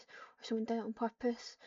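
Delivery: usual speaking voice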